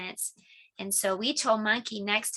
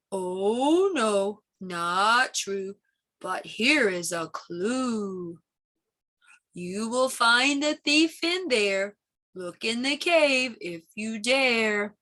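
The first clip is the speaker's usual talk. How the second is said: put-on slow, breathy old turtle voice; much slower than usual. Turtle character.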